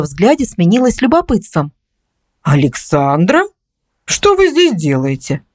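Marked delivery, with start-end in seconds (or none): 2.4-5.4 slow, drawn-out questioning; puzzled, stretch out the question; puzzled surprise. A narrator's reading. Neighbour finds an unexpected visitor at her door, puzzled surprise.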